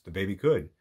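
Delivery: ordinary delivery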